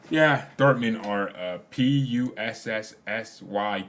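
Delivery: slow spelling with a long drawn S; slow mock spelling bee, S stretched out; spelled out letter by letter. Shown as a demonstration. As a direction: spell it out slowly, leaning on each S. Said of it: "I said the S a billion times".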